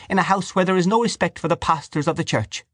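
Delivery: high-voiced, offended devout woman; high, indignant reproach; reproachful Dante character. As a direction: scold in a high, righteous voice.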